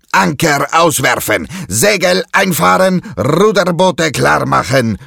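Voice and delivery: high, loud barked pirate commands; loud orders. A narrator's reading. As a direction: bark orders at the crew. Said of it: "befiehlt er lautstark".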